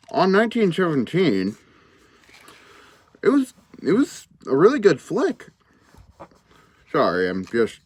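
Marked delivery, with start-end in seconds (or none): 0.1-5.5 talking around a pipe stem; words mumbled past a pipe; pipe in mouth. Real, not acted. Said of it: "struggling to light my pipe while talking".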